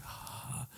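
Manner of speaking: very soft, voiceless sigh of pleasure; imitated hushed gasp; breathy gasp. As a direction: let out a tiny breathy "ah". Imitates his reaction to being grabbed.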